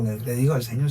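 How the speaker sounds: ordinary talk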